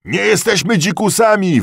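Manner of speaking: loud, very high shout; called-out protest. A narrator's reading. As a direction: shout down a rioting mob. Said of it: "wołali rozważniejsi". (głośne wołanie sprzeciwu)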